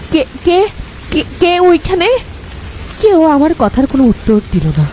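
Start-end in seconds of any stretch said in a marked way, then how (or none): 0.1-2.2 stammering in fear. Acted. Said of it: "আমতা আমতা করে".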